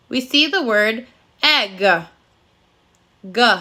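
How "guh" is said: G said as a hard guh; letter sound G. Shown as a demonstration. Teacher showing children the spelling's sound, G as guh. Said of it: "We only hear the G sound once".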